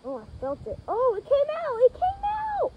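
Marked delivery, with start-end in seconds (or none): 0.9-1.8 high delighted cry; excited. Real, not acted. Seed pops out, delighted. 1.8-2.7 even higher delighted squeal; more excited. Real, not acted.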